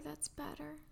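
quiet. Acted. Hushing partner near campfire.

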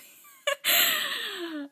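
high, loud burst of laughter; giggle fit. Real, not acted. Voice actor in a weird mood, helpless laughter. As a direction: crack up helplessly after a flub. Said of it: "all, like, giggly".

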